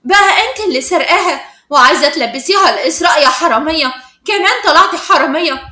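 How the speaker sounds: loud, angry accusation; shocked and agitated. A narrator's reading. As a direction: accuse her in outraged shock. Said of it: "وانصدمت وقالت بعصبية".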